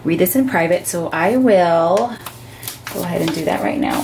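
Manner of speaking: ordinary delivery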